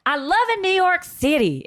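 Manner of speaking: high-pitched mock caption; hammed-up social post voice; Mama D impression. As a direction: pitch it up, imitating a gushing post. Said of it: "She just posts pictures".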